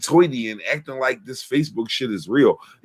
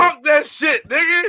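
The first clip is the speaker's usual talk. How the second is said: high-pitched cheer for the rapper; hyped shout. Real, not acted. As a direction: whoop it out, pitched way up. Hypes the rapper after verse.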